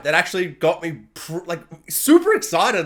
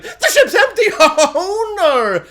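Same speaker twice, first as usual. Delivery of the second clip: much higher, louder, laughing; mock dismay; 10 semitones higher, 6 dB louder, much slower than usual. Imitating Vader finding the ship empty, laughing. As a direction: squeal it in hammed-up shock.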